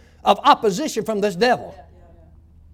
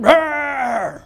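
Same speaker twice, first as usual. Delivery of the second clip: short rough growl; demon growl; 5 semitones higher, 6 dB louder than usual. Retold or imitated. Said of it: "it started growling like an".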